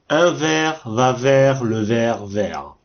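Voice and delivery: slow, careful reading of "ver", "vers", "vert"; slow teacher reading of a tongue-twister; slow demo sentence. Shown as a demonstration. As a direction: read it slowly so each "ver" is heard the same. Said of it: "Vous avez entendu plusieurs fois le mot ver".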